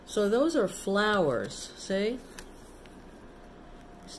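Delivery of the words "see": ordinary delivery, nothing marked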